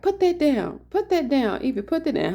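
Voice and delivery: panicked yelling. Retold or imitated. Owner yelling at her dog to drop the rabbit, panicked. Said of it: "I started screaming and cussing".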